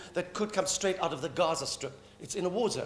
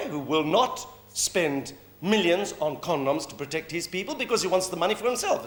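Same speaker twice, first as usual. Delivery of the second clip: normal talking voice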